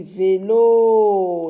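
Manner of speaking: "vélo" joined, with a long loud "looo"; word confirmed. Shown as a demonstration. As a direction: draw out the end of "vélo".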